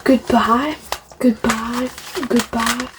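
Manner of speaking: ordinary talk, nothing marked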